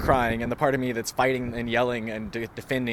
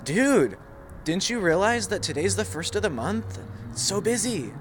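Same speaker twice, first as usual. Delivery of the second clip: boss scolding; 7 semitones higher than usual. Retold or imitated. Boss scolding, acted quote.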